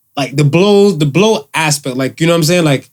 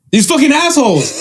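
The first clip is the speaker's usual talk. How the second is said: mock angry mother; 10 semitones higher than usual. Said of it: "She's like".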